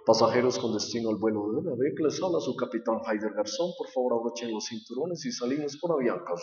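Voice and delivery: low, soft captain on the intercom; pilot announcement. Acted.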